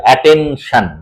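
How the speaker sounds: "অ্যাটেনশন" broken into syllables, a-ten-shan; syllable split. Shown as a demonstration. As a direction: say "অ্যাটেনশন" slowly, each syllable on its own. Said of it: "ইংরেজি সিলেবলের মতো".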